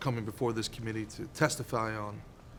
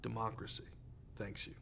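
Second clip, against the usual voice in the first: solemn hushed thanks; 15 dB quieter than usual. Acted. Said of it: "Would you stop?".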